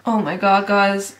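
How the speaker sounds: normal talking voice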